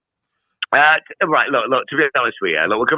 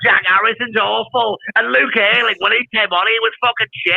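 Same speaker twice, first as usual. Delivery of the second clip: mocking United pundit; 8 semitones higher than usual. Taking off the pundits for his mates, high mock whine. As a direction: whine it like a gloomy fan, pitched up. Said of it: "you're going".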